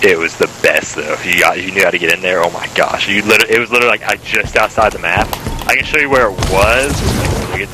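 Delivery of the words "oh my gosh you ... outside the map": ordinary talk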